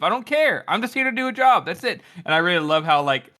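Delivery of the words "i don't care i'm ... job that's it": mock shout. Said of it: "And Shiver's just like".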